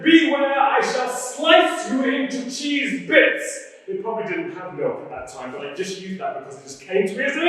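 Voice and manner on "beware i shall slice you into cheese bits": high, loud theatrical warning; mock-heroic threat. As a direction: declaim a drawn-out sword threat. Acted threat with huge sword.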